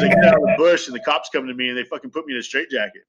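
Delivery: ordinary talk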